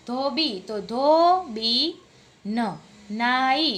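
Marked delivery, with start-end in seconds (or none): 0.1-2.6 ધોબીન drawn out, marking ઈન; teacher sounds out ધોબીન; slow teaching demo. Shown as a demonstration. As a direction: stretch ધોબી then ધોબીન. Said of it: "ઈ નો ઉચ્ચાર કરી અને ન".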